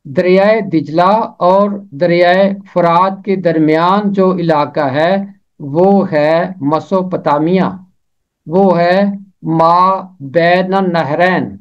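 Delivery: usual speaking voice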